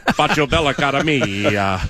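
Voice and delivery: laughing mock Italian accent. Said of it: "with your Italian accent there too".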